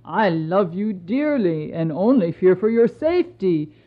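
lightly voiced tender husband; reassuring emperor. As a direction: comfort her and ease her shame.

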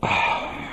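soft, airy sigh; satisfied sigh. Acted. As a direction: let out a sigh after drinking. Narcissus after drinking.

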